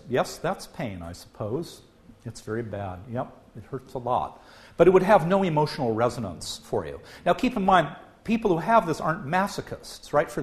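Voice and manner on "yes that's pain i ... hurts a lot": softer, emotionless monotone admitting pain; very flat voice. Pretend. Patient with damaged posterior insula shrugging off hammered thumb, flat and softer. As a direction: report the pain as if it barely matters. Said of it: "in a very flat voice".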